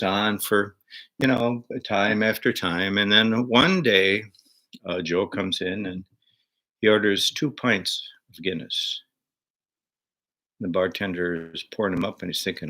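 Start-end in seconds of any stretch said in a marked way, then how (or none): none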